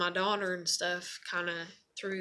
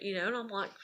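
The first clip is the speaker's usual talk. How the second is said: normal talking voice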